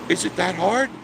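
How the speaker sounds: incredulous high, loud rising question; pointed question. Real, not acted. Host challenging listeners over keeping legs closed, pointedly. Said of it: "it's the way I said it".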